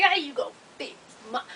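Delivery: softened imitation of him; creepy man's act. He questions the triage lady, hushed mimicry. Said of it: "He doing this shit".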